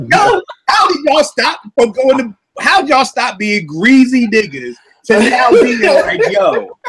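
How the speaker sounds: high-pitched words through laughter; incredulous laughing. Retold or imitated. Calling out suddenly reformed Twitter guys, laughing incredulously. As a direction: ask it in cracked-up disbelief. Mock questioning of Twitter guys' conversion.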